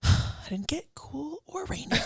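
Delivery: sighing, slow and soft whimper; hammed-up near-tearful disappointment; mock sobbing lament. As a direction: wail softly, as if cheated. Mock lament over London heatwave.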